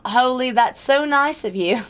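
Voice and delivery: slow, lightly put-on British accent; a quick British accent with a slow thank-you. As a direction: slip into a casual British accent. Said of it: "I immediately went British again".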